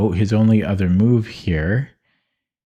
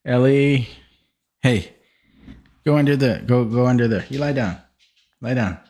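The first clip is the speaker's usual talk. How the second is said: firm dog command; 3 semitones higher, faster than usual. Real, not acted.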